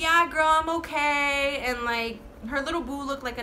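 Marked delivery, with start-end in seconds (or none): 0.0-1.6 put-on slow drunk girl voice. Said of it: "she's like".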